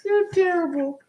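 high-pitched mock-hurt protest; playful protest. Real, not acted. Woman protesting partner's gym-scuff jokes, mock-hurt.

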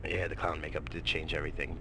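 whispered sarcastic aside. Real, not acted. Vlogger whispering a sarcastic aside to viewers, whispered. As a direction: hiss it out sarcastically without voice.

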